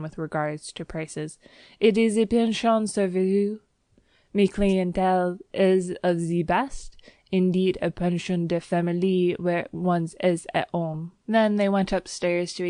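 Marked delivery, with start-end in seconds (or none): 1.8-11.1 put-on French-accented landlady. Landlady chattering at her new lodgers in French-accented English. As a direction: gush on like a Parisian landlady.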